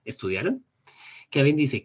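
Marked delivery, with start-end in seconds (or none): 0.0-0.6 "Estudiaron" as a simplified rising question; short questioning "Estudiaron", tune doing all the work; question intonation. Shown as a demonstration. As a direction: lift the end of "Estudiaron". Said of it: "yo podría simplificarla".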